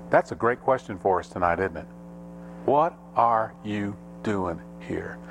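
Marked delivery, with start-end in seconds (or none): 2.6-5.2 "What are you doing here" slow, word by word; deliberate emphasis. Real, not acted. Preacher puts the question to congregation.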